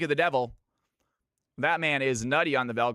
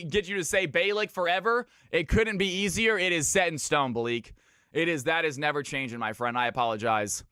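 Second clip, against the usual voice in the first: ordinary delivery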